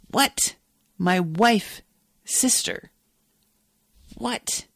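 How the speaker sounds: stunned, halting disbelief with long pauses; real bewilderment. Real, not acted. Reader reacts to the wife-sister slip, bewildered. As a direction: let each word drop out separately, baffled.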